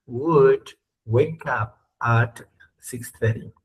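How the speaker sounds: slow model reading. Shown as a demonstration. Teacher reads the English example slowly to the class. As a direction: read slowly, word by word. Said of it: "Entonces dice".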